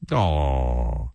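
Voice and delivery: long, low, falling "aw"; put-on disappointment, sinking in pitch; mock sympathy. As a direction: feign pity with a drawn-out sigh of a word. Mock sympathy for lost donations.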